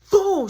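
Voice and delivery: shouted high boom as the game drops; loud boom sound effect. Retold or imitated. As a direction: bark out the crash. Game dropped on floor.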